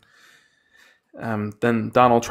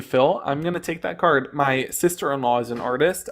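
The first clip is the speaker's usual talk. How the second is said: normal talking voice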